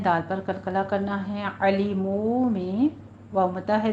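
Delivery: usual speaking voice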